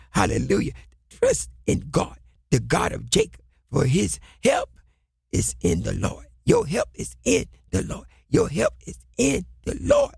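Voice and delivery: ordinary talk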